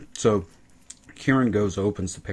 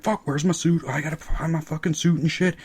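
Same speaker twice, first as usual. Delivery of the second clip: higher put-on frantic search; mock groggy Kieran; 8 semitones higher than usual. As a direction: scramble for keys in a hammed-up voice. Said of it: "he's like".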